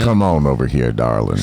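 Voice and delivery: lightly voiced low crooner.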